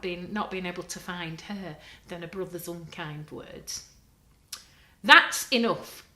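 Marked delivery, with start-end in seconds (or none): 5.0-6.0 loud, slow stern shout. A narrator's reading. Mum shouting at the children, loud and stern. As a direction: Mum shouting to stop the squabble. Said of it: "shouted Mum".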